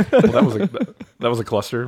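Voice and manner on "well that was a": high laughing start, louder; laughing at the botched intro, higher and louder. Real, not acted. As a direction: crack up as you begin.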